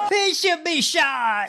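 high, stretched mock crowd yell; imitated yelling. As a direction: yell it like a heckler at a rally. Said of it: "someone yelling".

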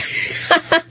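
sudden breathy laugh; short incredulous laugh; burst of laughter. Real, not acted. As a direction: laugh it off in disbelief. Laughs at Maryland taxes.

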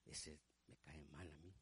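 very low, hushed aside; confiding grumble. Acted. Deacon confiding his dislike to a brother, hushed grumble. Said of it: "Y me dice".

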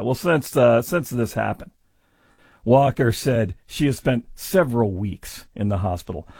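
usual speaking voice

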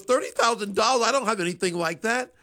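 mock shocked grandma at the price; shocked mother. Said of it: "And my mother goes".

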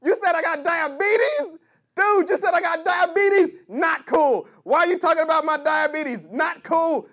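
loud, high indignant outburst; mock berserk rant. Comic yelling at the host, loud mock outrage. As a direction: act out an offended comic losing it. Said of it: "And the guy went berserk".

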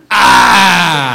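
mock scream at a ringing phone; startled scream. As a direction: yell "ah" as if terrified by the phone. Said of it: "first time the phone had ever rang".

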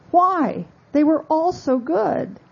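high, lightly voiced royal reply; a mild attempt at a British queen; politely high-pitched, half-attempted British queen; Queen Elizabeth. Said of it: "I can't do a British accent".

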